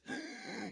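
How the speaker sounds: a sharp, breathless gasp for air; sudden gasp. Retold or imitated. Retelling grandpa's deathbed breath, a sharp gasp. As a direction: gasp like a dying man catching breath.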